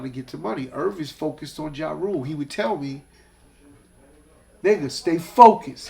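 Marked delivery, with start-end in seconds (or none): 4.6-5.9 retold scolding.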